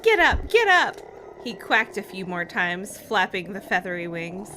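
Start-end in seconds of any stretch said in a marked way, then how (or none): 0.0-1.0 loud, high shout. Acted. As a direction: yell at him to get up. Girl pinned under her brother.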